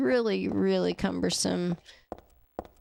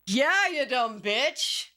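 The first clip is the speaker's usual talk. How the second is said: mock villain voice; 4 semitones higher, much slower than usual. Slow put-on voice insult.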